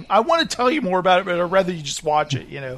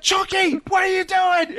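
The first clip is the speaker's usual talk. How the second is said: mock yell, shouting at the man in the suit; imitating a yell; 12 semitones higher than usual. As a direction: yell at him in disbelief. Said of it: "he's just yelling at the guy".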